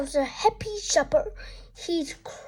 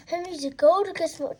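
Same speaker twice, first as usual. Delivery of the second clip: usual speaking voice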